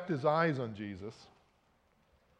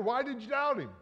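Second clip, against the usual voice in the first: scolding; 8 semitones higher, faster than usual. Retold or imitated. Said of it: "and say".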